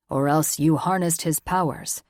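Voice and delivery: a hint of a stern elder reporting news; Tor, lightly voiced gruff father. Tor's voice.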